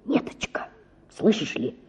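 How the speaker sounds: почти шёпотом; шептать, чтобы никто не услышал; тихо, заговорщически. A narrator's reading. Отец тайно просит денег. (отец тайком шепчет дочери просьбу)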